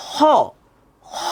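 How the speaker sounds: "খ" as a raspy back-throat fricative; letter drill. Shown as a demonstration. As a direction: scrape the breath on "খ". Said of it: "একটু বলার চেষ্টা করেন".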